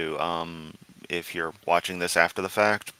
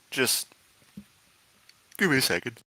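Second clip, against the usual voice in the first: yawning; 6 semitones higher than usual. Real, not acted. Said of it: "the yawns came up".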